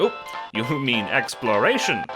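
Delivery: usual speaking voice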